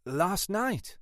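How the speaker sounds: high-pitched, mildly alarmed question. A narrator's reading. Sergeant startled by boss's request, alarmed. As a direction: echo it with alarm, pitch jumping up. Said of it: "alarmed by the request".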